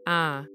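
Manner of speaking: "ah" as a single flat vowel; North Yorkshire vowel. Shown as a demonstration. Explaining phonetics to listeners, North Yorkshire "ah". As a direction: say "ah" without any bend in it. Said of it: "North Yorkshire I is closer to".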